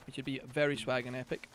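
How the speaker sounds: ordinary talk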